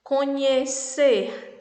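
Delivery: Conhecer drawn out slowly, co-nhe-cer; syllable by syllable. Shown as a demonstration. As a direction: stretch Conhecer into three separate syllables. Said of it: "três sílabas".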